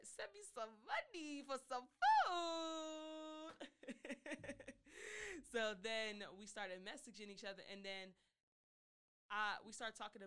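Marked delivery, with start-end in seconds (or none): 0.0-3.5 higher, louder, slow, sing-song ask; drawn-out playful begging; mock whiny plea. 3.6-5.5 laughing. Real, not acted. Laughs after her joke.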